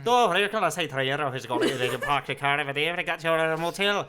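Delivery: hammed-up Irish accent, laughing through; loud over-the-top Irish accent; put-on Irish accent. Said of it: "the most put on Irish accent".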